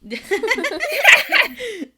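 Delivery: loud, high cackling laugh; burst of laughter. Real, not acted. Host reacting to co-host's singer remark, laughing loudly.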